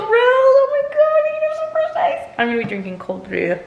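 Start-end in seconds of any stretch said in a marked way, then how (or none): 0.0-2.2 delighted squeal. Real, not acted. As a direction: squeal with joy over the gift. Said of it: "I'm thrilled".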